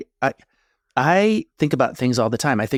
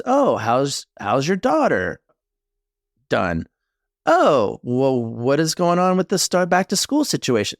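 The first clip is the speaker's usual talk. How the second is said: bright, chatty, higher mock small talk; mimicking chatty small talk; 4 semitones higher, slower than usual. Imitating wife chatting with a friend in the front room, higher and chatty. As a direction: play her breezy chatter with a friend.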